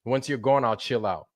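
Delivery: usual speaking voice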